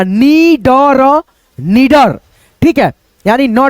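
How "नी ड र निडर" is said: नी ड र निडर sounded out syllable by syllable, very slowly; teacher spelling out नी, ड, र before blending into निडर. Shown as a demonstration. Teacher sounding out a word for young pupils, very slowly. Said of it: "तो ये क्या बना".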